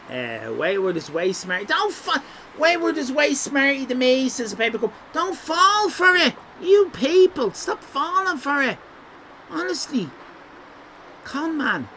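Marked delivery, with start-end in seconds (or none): 1.7-11.9 high-pitched mock alarm; mock warning. Streamer teasing chat not to trust Wayward, high mock alarm. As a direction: warn the crowd in a high playful panic.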